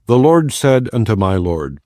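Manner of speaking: ordinary delivery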